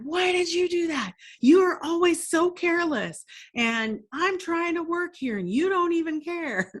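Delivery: hammed-up furious dad scolding; exploding dad. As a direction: blow up at a careless kid. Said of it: "And he explodes".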